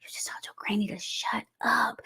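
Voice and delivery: aghast whisper, imitating husband; faint with shock. Husband overhearing wife's call with granny, shocked whisper. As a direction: whisper it as if scandalized. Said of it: "like to faint".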